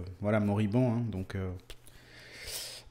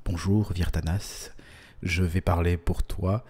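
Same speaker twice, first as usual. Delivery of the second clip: deep intimate voice; 6 semitones lower than usual. Pretend. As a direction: speak low right into his headphones. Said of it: "je parle dans tes oreilles".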